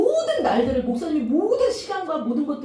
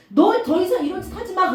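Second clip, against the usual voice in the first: raised, higher stern warning; prophet's rebuke; 7 semitones higher, 6 dB louder than usual. Retold or imitated. Prophet Zechariah warning the sinning king, loud and stern. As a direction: scold him like a prophet.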